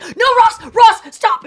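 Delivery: high, loud, frantic pleading; hammed-up desperate cry to stop; panicked mock shout. Woman lunging for the phone from her ex, imitated panic. Retold lunge to stop him listening.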